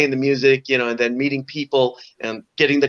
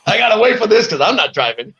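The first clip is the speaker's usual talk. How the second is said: laughing; 7 semitones higher than usual. Real, not acted. Joking about hearing song.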